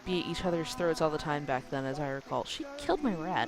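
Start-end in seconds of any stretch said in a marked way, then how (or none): 2.4-3.5 put-on childish whine. Sulky retort to mother.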